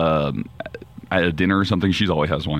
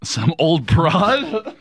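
mock offended; 4 semitones higher, much slower than usual. Real, not acted.

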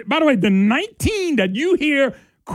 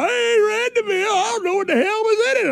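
mocking senator; 11 semitones higher, faster than usual.

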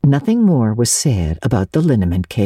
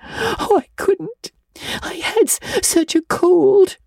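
tearful wail; slower than usual. A narrator's reading. Anne excuses herself to Marilla, dissolved in tears. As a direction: sob it out in a high girl's voice. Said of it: "Anne dissolved into tears".

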